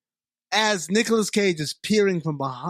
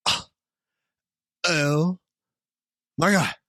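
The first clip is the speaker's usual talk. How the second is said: put-on California Valley accent. Podcaster voicing alien Brax with a mild California Valley accent, stunned. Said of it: "that's your fucking California accent".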